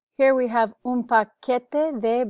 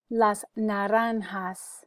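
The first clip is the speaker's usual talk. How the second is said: "Las naranjas" slow and syllable-clear; slow, deliberate "Las naranjas" for repeating; careful pronunciation model; slower than usual. Shown as a demonstration. Teacher gives the word for oranges, slow careful model. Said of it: "You can repeat for pronunciation".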